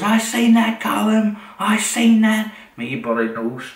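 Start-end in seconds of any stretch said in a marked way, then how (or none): 0.0-2.5 put-on high, loud old woman voice; imitating Annie. Annie to Square, high loud imitated old woman voice. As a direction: mimic her shrill-free high cackle of a voice, much higher and louder. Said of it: "she goes".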